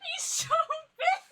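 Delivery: very high, slow, awestruck squeals; delighted squeal. Real, not acted. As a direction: gush over the giant plush. Unboxing giant plush, delighted.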